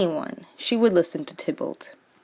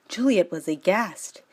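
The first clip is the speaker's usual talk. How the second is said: normal talking voice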